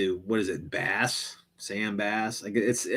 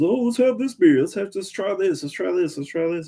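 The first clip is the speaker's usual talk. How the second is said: high-pitched, eager mock college guy; goofy put-on student voice; dumbass college guy; 9 semitones higher, 4 dB louder than usual. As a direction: imitate an overeager college drinker rattling off suggestions. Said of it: "when you're a dumbass college guy".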